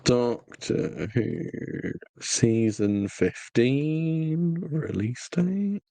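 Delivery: drawn-out words while typing a search; very slow, stretching each word as you type; typing aloud. Real, not acted. Host reads out a quick Google search, typing aloud.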